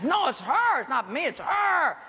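high, insistent denial; protesting blame. Retold or imitated. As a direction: point the finger, voice jumping up. Blaming the lady to cop.

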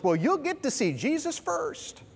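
high, slow mock cheeriness; naive consoling. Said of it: "well-meaning but naive Christians".

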